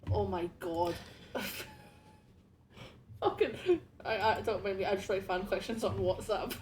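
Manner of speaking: usual speaking voice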